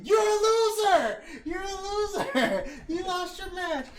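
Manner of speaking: high, loud slow taunt, laughing; mocking taunt. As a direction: jeer at him and laugh. [name] taunts Peter.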